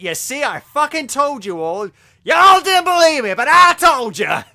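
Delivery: loud, high American shout; gloating mock-American yell; hammed-up American accent. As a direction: holler it like an exaggerated American, building to a yell. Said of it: "I don't recall having an accent like that".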